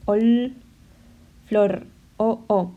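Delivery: ol and flor pushed out, the shared o held; marking the vowels. Shown as a demonstration. Teacher demonstrating assonant rhyme, emphatic. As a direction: make the shared vowel o heard. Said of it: "¿Veis?".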